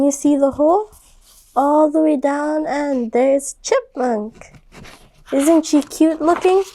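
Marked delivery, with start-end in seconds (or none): none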